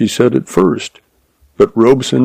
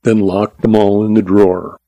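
usual speaking voice

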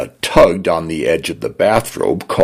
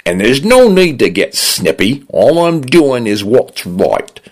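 less bright; 7 semitones higher than usual. A narrator's reading. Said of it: "Brightness fell from the voice".

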